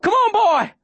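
very high urgent call; yell. A narrator's reading. Girl calling dog back to boat, high urgent yell. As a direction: yell the name high and long. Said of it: "Viola yells".

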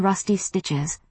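ordinary delivery, nothing marked

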